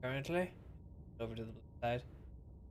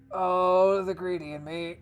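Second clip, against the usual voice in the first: excited; 6 semitones higher, 12 dB louder, slower than usual. Real, not acted. Hears the resurrection is paid for.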